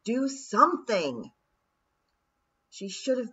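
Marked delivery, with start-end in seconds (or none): 0.0-1.3 louder, strained yell; hoarse shout. A narrator's reading. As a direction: shout with effort. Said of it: "hoarse with effort, shouted".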